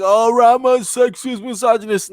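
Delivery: high-pitched mock outrage; mock accuser. Imagined viewers attacking the host, mock accusing. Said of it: "Don't attack me like".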